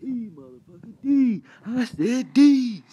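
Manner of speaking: high, loud, slowed shout of D; yelling the name D at a friend; insistent yell. Retold or imitated.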